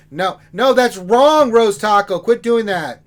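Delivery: loud, high exasperated scolding; mock scolding. Real, not acted. Host telling a viewer off for the wrong word, loud scolding. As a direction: tell off the chat like a fed-up teacher.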